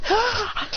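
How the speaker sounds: sharp high yelp into the air. Acted. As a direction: yelp as you fly. Blasting up into the air.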